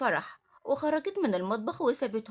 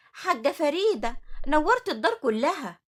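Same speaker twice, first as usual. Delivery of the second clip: loud, higher-pitched gushing welcome; effusive greeting; 4 semitones higher, 6 dB louder than usual. Acted. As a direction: welcome an important visitor with a flourish. Supervisor greets the arriving director.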